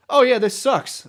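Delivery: mock realization. Moved to the city and regretting it, mock dismay. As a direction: act out a sudden letdown.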